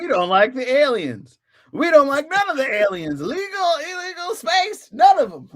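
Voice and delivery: high, loud hammed-up bigot voice; mock xenophobic rant. Earth crowd rejecting the aliens, high mock yelling. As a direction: rant like a cartoon alien-hater.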